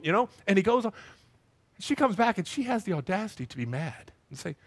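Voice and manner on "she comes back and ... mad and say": ordinary talk, nothing marked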